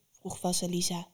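ordinary delivery

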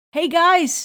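high, loud call to the group; shouted hail to get their attention. Retold or imitated. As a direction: call out to the friends walking away. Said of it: "wat ik nog kan roepen".

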